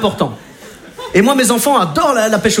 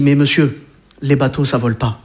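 condescending; 8 semitones lower than usual. Retold or imitated. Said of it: "regardé comme un demeuré".

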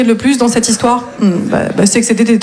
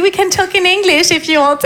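higher, put-on flirty English; drague en anglais; 7 semitones higher than usual. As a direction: offer it coyly, pitched up. (anglais aguicheur, voix haute)

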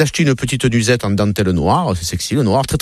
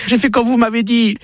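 high, pleading woman's voice, well above normal; wife begging her doctor for help in a high, pleading voice; distressed wife; 11 semitones higher than usual. Acted. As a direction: whine to the doctor about her husband. Sarah reports back to the doctor.

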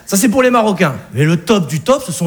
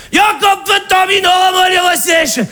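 Alsatian father; 6 semitones higher than usual. Acted. The Alsatian father greeting his daughter, effusive and high. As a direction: gush over the returning daughter. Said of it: "Le père alsacien nous aperçoit".

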